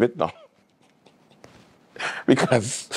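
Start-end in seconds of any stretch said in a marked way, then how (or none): none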